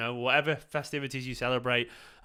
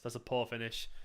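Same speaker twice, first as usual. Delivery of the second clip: repeated much softer, to himself; quiet aside; 3 semitones lower, 10 dB quieter than usual. Real, not acted. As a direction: mutter it again under your breath.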